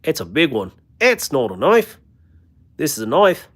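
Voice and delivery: a hammed-up Aussie knife line, mildly done; bad Australian accent. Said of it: "most horrible Australian impression".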